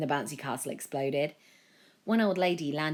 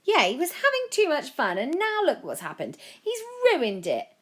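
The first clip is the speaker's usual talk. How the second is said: much higher, louder shrill complaint; angry mother; 11 semitones higher, 5 dB louder than usual. A narrator's reading. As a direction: yell it like a fed-up mum. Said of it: "a mother yelled".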